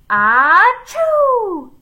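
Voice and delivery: loud, high hammed-up achoo; huge sneeze. A narrator's reading. Mister Gorf sneezing hard, a loud mock achoo. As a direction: sneeze with your whole body. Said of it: "sneezed so hard".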